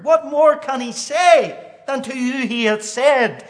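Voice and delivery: high, loud preacher's cry building to a peak; fervent preaching. Acted. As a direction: preach it out with rising fire. Preacher rising to full force.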